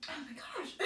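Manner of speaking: laughing through tears; teary laugh; happy crying. Real, not acted. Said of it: "I already cried".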